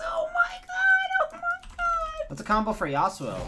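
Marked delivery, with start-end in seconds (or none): 0.0-2.3 breathless gasp. Real, not acted. Streamer reacts right after screaming.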